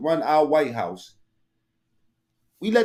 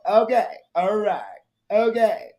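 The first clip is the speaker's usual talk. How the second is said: mock chirpy okays; 6 semitones higher, slower than usual. Mimics clip.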